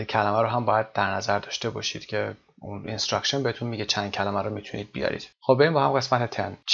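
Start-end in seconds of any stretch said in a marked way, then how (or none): none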